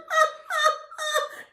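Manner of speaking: high squeaky cackle; goblin laugh. Acted. Tiny goblin mocks Herschel, squeaky high cackle. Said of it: "The goblin laughed".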